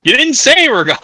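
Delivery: high, loud burst of protest; indignant protest. Real, not acted. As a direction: raise it sharply in playful outrage. Protests teasing friend's absence.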